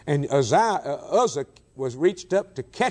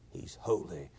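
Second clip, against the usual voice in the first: much lower, much softer; hushed awe; 6 semitones lower, 12 dB quieter than usual. Real, not acted. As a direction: sink to a near whisper. Repeat sinks to hush.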